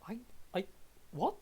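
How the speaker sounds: falter, then blurt in confusion; baffled. Acted. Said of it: "a face that's literally saying".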